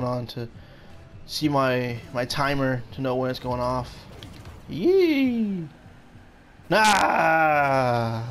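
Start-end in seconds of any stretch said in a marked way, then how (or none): 4.7-5.7 long squealed "yee"; high whoop. Real, not acted. Exclaiming during play. 6.7-8.2 loud, drawn-out cry; yelled refusal. Real, not acted. Yell during play.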